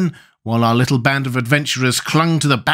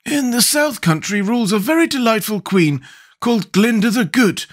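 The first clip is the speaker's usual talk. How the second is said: high-pitched character voice; rally the others in a raised voice; Scarecrow's voice; 11 semitones higher than usual. A narrator's reading. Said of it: "said the Scarecrow".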